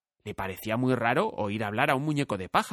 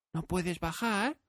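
put-on higher, softer, slow girl voice; concerned girl; 7 semitones higher, 6 dB quieter, slower than usual. A narrator's reading. Dorothy asks the scarecrow if he can get down. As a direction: ask gently, worried.

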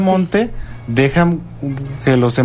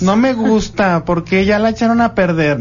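whiny grumpy adult; 7 semitones higher than usual. Retold or imitated. Mimicking adults who scorn youth.

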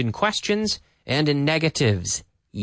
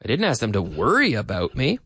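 "worry" pitched up, with "me" rising as a question; stress on WORRY; 4 semitones lower than usual. Shown as a demonstration. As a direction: lean on the word "worry". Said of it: "intonation gives a very different feel".

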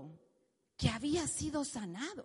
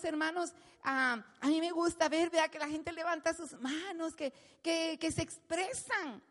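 normal talking voice

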